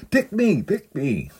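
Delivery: high, loud imitation of an eager cry; mock yell. Husband hidden in clothing rack to shoppers, high mock yell. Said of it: "he yelled".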